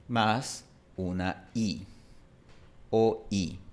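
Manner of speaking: "O I" separados, despacio; dos sonidos. Shown as a demonstration. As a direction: di la O y la I por separado, lento. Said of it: "escuchando una O". ("O" e "I" como dos sonidos)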